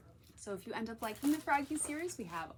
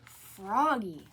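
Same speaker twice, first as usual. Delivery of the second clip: loud stern call; 2 semitones lower, 7 dB louder than usual. A narrator's reading. Librarian calling Froggy to stop, loud stern call. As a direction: call him out firmly. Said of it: "called Missus Otterbottom".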